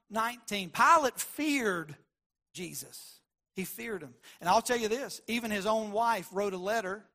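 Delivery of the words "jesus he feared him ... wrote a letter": ordinary talk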